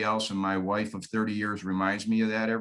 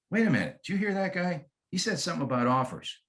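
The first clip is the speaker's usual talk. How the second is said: lightly voiced homeowner, quicker pace; quick, lightly acted double take; intrigued homeowner; 6 semitones higher, faster than usual. Homeowner to spouse about the caller's message, lightly acted intrigue.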